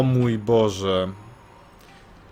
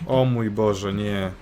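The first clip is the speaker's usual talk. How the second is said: ordinary delivery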